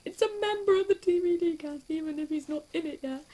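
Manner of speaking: high, loud squealing excitement; fangirl squeal. Retold or imitated. Fan squealing over a tweet from a cast member. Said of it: "I just squeal".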